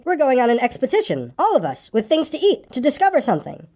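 high, excited rush of news. A narrator's reading. Pooh telling Piglet about the expedition, excited. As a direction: announce it in eager, lifted phrases. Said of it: "said Pooh excitedly".

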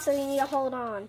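ordinary talk

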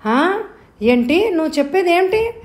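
high, loud incredulous question; shocked disbelief. A narrator's reading. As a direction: react as if you can't believe your ears. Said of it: "తన చెవులు తానే నమ్మలేకపోయింది".

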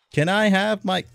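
high, loud exasperated demand. Real, not acted. Gamer demands his item back from a villager, exasperated.

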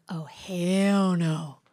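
very slow, stretched-out mock dread; drawn-out refusal. Driver reacting to wipers moving by themselves, slow drawn-out refusal. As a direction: stretch each word in retold disbelief.

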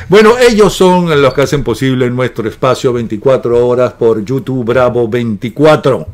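ordinary talk

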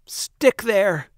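high-pitched, exasperated yell; angry yell. A narrator's reading. Baker flings dough at the ceiling, angry yell. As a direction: snap a furious order upward. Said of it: "he yelled at the dough".

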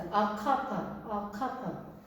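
"a cup" said as one smooth run with no F sound; 'of'의 F 소리 없이 "a cup". Shown as a demonstration. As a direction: blend "a cup" together without any F. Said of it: "F 소리가 안 나요".

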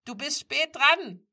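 high, loud, slow call; mother calling from the kitchen. A narrator's reading. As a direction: call through the house, drawn out. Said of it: "empfing ihre Mutter sie aus der Küche rufend".

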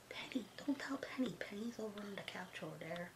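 hushed, voiceless secret whisper. Real, not acted. Whispering to the doll so Penny the dog won't hear.

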